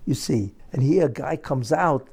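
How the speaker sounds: normal talking voice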